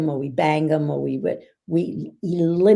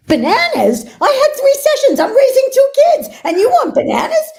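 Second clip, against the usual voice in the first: high, loud, indignant retort, half laughing; defensive outburst; 10 dB louder than usual. Retold or imitated. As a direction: fire back at the husband, exasperated and overwhelmed. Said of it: "Honey, you're very defensive".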